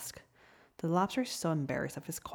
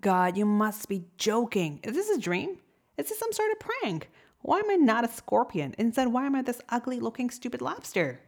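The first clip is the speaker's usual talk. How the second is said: louder, much higher, complaining to God; a betrayed creature railing at heaven; upset protest; 6 semitones higher, 4 dB louder than usual. Acted. Lobster complaining to God about his new body, upset.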